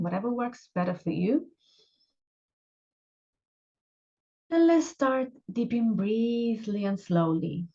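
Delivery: unhurried meditation guide, long pauses between phrases; guided meditation. Acted. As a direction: gently guide them into relaxation, leaving space after each instruction.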